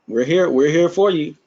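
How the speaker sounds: ordinary delivery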